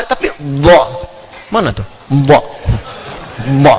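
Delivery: bo, a failed attempt at the dad sound. Shown as a demonstration. Teacher shows that other languages cannot say the letter, failed dad. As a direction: try the dad and land on bo. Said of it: "ba ada, ha".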